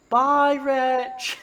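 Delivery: high, loud drawn-out farewell; child calling out. Acted. Boy waves to the fleeing goblin, childlike calling. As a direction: call it out high like a kid.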